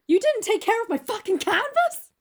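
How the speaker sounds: high, loud mock outrage; hammed-up indignant shout; upset painter. Painter to Dorian about his canvas, upset mock yell. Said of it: "Maybe he was just upset".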